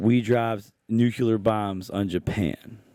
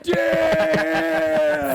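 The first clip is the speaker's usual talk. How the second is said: amazed; 4 semitones higher, 3 dB louder than usual. Retold or imitated. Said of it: "we were like".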